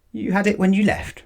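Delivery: incredulous repeat. A narrator's reading. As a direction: repeat his words in disbelief. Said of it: "repeated Mister Brown".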